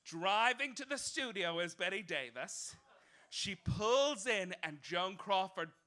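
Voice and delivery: ordinary talk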